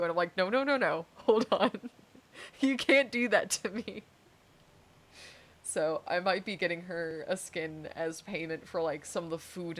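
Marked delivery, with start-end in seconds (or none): 0.4-4.0 louder, laughing no-no-no; mock protest to a coworker, laughing. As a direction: playfully refuse through a laugh.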